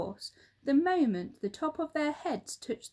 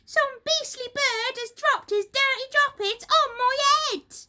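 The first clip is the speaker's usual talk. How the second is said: high, loud disgusted hag voice; outraged Missus Twit; 9 dB louder than usual. A narrator's reading. Missus Twit complaining about bird droppings, high loud outrage. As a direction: spit out the disgust like a furious old wife.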